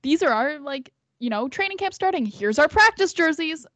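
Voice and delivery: high, loud, peppy team-announcement voice; mock announcement. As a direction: sell it like a cheerful team post. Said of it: "So I thought it was gonna be like".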